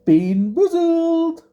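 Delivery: stretched out, high title announcement; game show hype. Acted. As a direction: drag out the name like a host.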